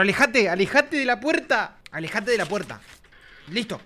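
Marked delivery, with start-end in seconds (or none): 0.0-1.8 high, drawn-out call; pleading call. Real, not acted. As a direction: call out insistently, stretching the words. Ordering game character away. (llamado insistente y agudo)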